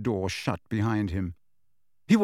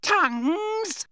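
much higher, slow, singsong taunt; mocking poltergeist. A narrator's reading. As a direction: tease him from above. Peeves mocking after raspberry.

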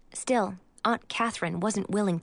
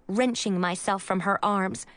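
usual speaking voice

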